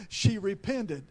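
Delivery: usual speaking voice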